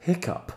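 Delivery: hiccup said as English "hik-up". Shown as a demonstration. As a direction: model hiccup for learners. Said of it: "on écoute [name]".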